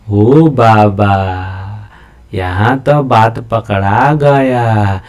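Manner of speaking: long drawn-out mock lament; slow, teasing "oh dear" delivery; mock dismay. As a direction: stretch every word out slowly, playfully. Mock reaction to being caught out.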